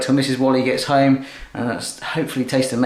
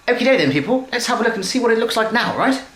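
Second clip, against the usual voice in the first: high-pitched mock posh English accent; 8 semitones higher than usual. Cook welcomes viewers back to the dish, mock posh English accent. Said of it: "posh English accent there".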